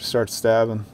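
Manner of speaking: usual speaking voice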